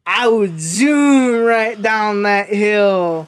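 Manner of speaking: slow, loud drawn-out boast; mock Tweaker Ryan boast. Retelling Tweaker Ryan's answer, a slow loud bragging drawl. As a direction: ham up a dopey daredevil brag. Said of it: "you didn't do it justice".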